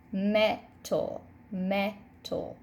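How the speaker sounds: "metal" split as met, al; separated syllables. Shown as a demonstration. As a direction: clear pause inside "metal" after met. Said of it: "try to separate the syllables in this word".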